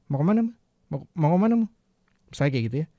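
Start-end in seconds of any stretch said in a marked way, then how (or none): 0.1-1.7 "Mau ke mana, Mu?" run together, "kamu" cut to "Mu"; quick, squashed "Mau ke mana, Mu?"; casual contraction. Shown as a demonstration. Teacher giving an everyday example, contracted "Mau ke mana, Mu?". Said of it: "kayak misalnya".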